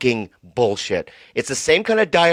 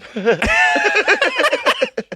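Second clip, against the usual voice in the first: high laugh. Real, not acted. Laughing at the joke.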